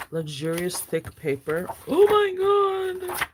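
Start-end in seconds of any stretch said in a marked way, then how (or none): 1.9-3.3 slow awed excitement. Real, not acted. Reacting to planner paper.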